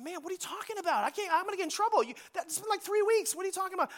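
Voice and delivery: panicked what-are-you-talking-about; alarmed protest. Retold or imitated. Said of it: "I said".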